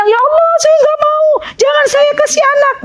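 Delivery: high-pitched mock plea of refusal; mock protest. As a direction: act out a woman protesting to God. Imagined protest of Maryam to God.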